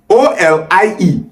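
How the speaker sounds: ordinary delivery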